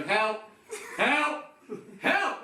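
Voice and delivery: gather courage and call out, rising; building call for help. Retold or imitated. Stranded on a cliff, calling out for help, building up. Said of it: "You start to go".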